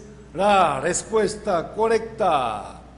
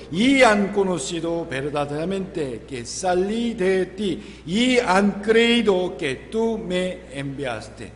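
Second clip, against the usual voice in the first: scripture read aloud together; 2 semitones higher, 4 dB louder than usual. Acted. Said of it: "Leamos juntos".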